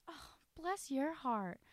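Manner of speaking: high, slow, syrupy pity; older church lady sweetly pitying a hungover stranger. Retold or imitated. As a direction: drawl it like a church lady judging you. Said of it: "And she goes".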